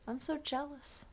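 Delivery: normal talking voice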